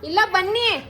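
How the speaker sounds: high-pitched call to someone away. Real, not acted. Calling elder sister to come, high calling voice. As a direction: raise the pitch to carry across the house.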